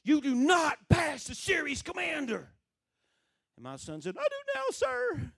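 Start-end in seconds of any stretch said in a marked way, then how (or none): 0.0-2.5 loud, high officer's shouting; in-his-face reprimand. Retold or imitated. Commander dressing down recruit in his office, shouting. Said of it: "the guy got in his face". 4.2-5.3 very high, slow drawn-out reply; recruit's high yelp. Retold or imitated. Son answering commander in his office, high-pitched call.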